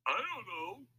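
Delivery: high crook voice, at a loss; stumped crook. A narrator's reading. Louis has no plan for Lefty, stumped crook. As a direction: admit it in a high voice.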